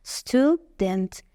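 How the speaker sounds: استودنت very slowly, stu then dent; two separate beats in استودنت, no leading e; correct two-syllable split. Shown as a demonstration. Teacher counting two syllables, correct split. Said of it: "پس شد دو تا سیلاب".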